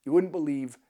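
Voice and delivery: usual speaking voice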